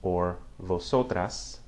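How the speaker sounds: "vosotras" said slowly as bo-SO-tras; slow Spanish vosotras. Shown as a demonstration. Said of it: "they would say vosotros".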